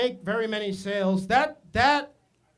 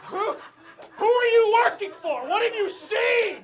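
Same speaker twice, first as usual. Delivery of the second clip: panicked yelling; 11 semitones higher than usual. Retold or imitated. Comic yelling at the kid on the bike, high and frantic. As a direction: yell in paranoid panic. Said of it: "I'm just yelling at him".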